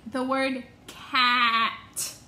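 slow, deliberate teacher model; slow model word. Shown as a demonstration. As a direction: say the word slowly for the class. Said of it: "Let's do a practice".

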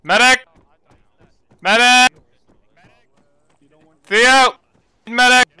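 shouting for a medic across the field; man down shout. Real, not acted. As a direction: yell it out, urgent and loud.

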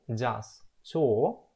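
ordinary talk, nothing marked

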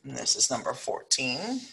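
ordinary delivery

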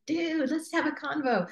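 high, friendly invite with a casual dude; super welcoming. Retold or imitated. Coach showing listeners her welcoming tone, high and friendly. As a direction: pitch it up and welcome them in like a buddy. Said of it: "you can see I'm very welcoming".

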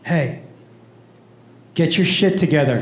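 low, firm private rebuke; stern friend. Retold or imitated. Friend leaning over to the drunk woman, low and stern.

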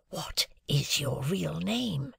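slow breathless whisper. A narrator's reading. Pip asking the convict his true name, whispered. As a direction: ask it under your breath, slowly. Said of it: "I asked him in a whisper".

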